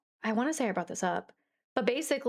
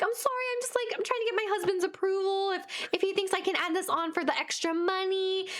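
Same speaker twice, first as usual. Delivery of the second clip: high, mock-apologetic stalling; pitched-up pleading excuse; retold flustered excuse; 8 semitones higher than usual. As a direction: stall nervously, acting it out. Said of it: "I'm freaking out".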